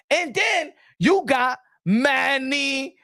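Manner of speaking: building, high, shouted ring-announcer hype; ring announcer intro. Acted. Hyping up fans for Pacquiao's return, announcer shout.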